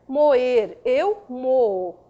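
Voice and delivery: ordinary talk, nothing marked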